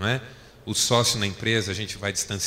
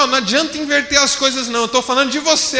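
higher and louder, insisting; raised voice in a quarrel; 13 semitones higher, 8 dB louder than usual. Retold or imitated. Husband insisting loudly during the quarrel with his wife. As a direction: refuse to let her turn it around. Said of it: "subir um pouco a voz".

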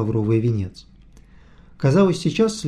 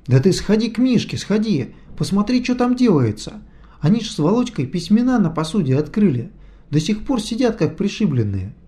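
excited; 7 semitones higher than usual. A narrator's reading. Vityukha urging her excitedly.

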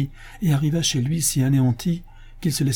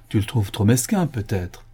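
plain reading voice

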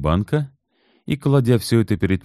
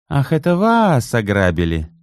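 higher, mocking drawn-out line; mocking drawl; 7 semitones higher, 3 dB louder than usual. Natalya mocking the robbed men, sneering drawl. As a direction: stretch it out to mock him. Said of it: "насмешливо протянула Наталья".

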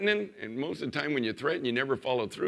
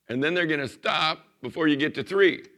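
usual speaking voice

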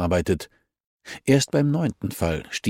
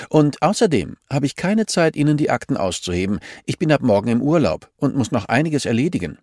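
put-on higher, curt, hurried old woman; grumpy archivist; 6 semitones higher, 3 dB louder than usual. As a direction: brush her off impatiently. (gespielte mürrische Archivarin, höher und kurz angebunden)

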